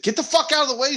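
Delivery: high-pitched exasperated retold outburst; annoyed mock yell; exasperated order. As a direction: shoo the dog away, pitch jumping up. Snapping at dog underfoot.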